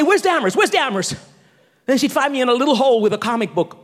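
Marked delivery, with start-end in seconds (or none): 0.0-1.1 imitating mom hunting for her child; higher-pitched mock motherly call. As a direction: call out for a missing kid, as mom. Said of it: "My mom would say".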